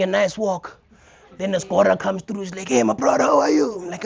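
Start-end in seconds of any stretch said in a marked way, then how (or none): 2.7-3.7 gym guy greeting. Retold or imitated. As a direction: greet him like an eager gym regular. Gym regular greeting him.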